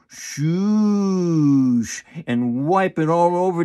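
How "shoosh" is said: drawn-out, sweeping shoosh; long wiping shoosh. Shown as a demonstration. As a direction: smear it slowly over everything. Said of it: "Or you can just go".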